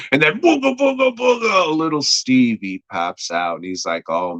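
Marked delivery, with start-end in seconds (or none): 0.4-1.8 high, wobbly mock monster noise; spooky booga-booga. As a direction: jump out with a high-pitched booga-booga. Imitates melted Stevie popping out.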